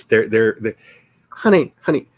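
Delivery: ordinary talk, nothing marked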